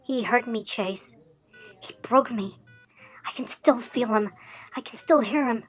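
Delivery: hushed, low and halting; small, haunted voice; traumatised confession. Acted. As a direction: confide the hurt very softly. Traumatised victim recalls his abuser.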